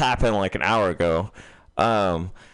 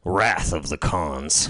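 deadpan pun; 9 semitones lower, slower than usual. Real, not acted.

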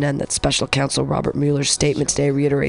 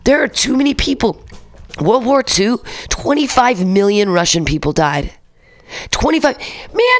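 ordinary talk

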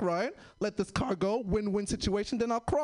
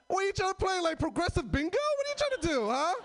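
high incredulous questioning; mock exasperation; 8 semitones higher than usual. Mocking the overly nice driver, high incredulous scoff.

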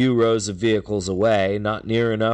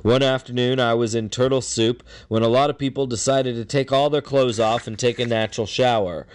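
ordinary talk, nothing marked

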